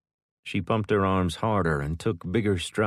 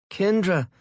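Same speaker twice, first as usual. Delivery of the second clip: weak attempted yell; 13 semitones higher, 5 dB louder than usual. A narrator's reading. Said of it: "Savannah tried to yell".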